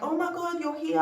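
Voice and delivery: high surprised exclamation; surprised doctor. Retold or imitated. Doctor greeting her patient, high and surprised. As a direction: echo the doctor's startled delight.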